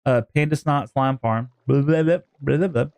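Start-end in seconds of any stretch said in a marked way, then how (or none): none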